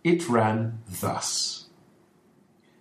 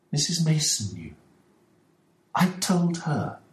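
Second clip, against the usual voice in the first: gentle wife; 8 semitones higher than usual. A narrator's reading. Missus Ferguson answers Holmes.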